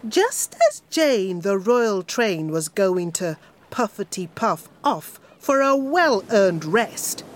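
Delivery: ordinary delivery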